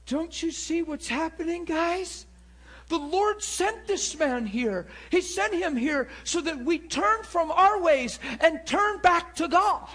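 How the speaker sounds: high, loud, building retold cry; impassioned exhortation. Spanish girl urging the people back to God, crying out.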